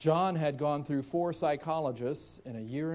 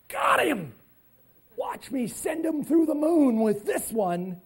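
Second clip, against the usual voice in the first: high, loud gloating outburst; gleeful negaholic; 10 semitones higher, 5 dB louder than usual. Acted. Negaholic reacting to someone saying cheese, gleeful gloating. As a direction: crow it, pitched way up and drawn out.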